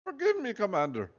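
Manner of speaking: high pleading fake Russian accent; hammed-up Russian accent. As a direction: beg the commander in a thick hammed-up Russian accent. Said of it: "proud of what I did with the Russian accent".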